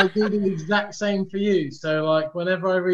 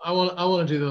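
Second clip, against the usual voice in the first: usual speaking voice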